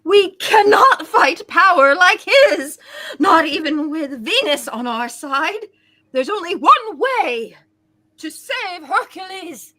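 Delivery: loud, dramatic hero voice; overacted heroic. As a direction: ham it up like a stage hero. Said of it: "we're all doing overacting tonight".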